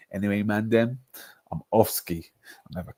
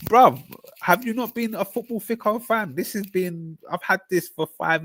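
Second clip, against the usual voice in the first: indignant protest; 10 semitones higher than usual. Real, not acted. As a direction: defend yourself in disbelief. Indignant at viewer's remark.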